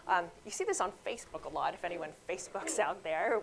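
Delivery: usual speaking voice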